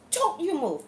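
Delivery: high-pitched imitation of a screaming neighbor; acted yell, pitched well up; retold scream. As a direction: mimic a neighbor yelling at a child. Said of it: "one of my neighbors screaming at this little girl".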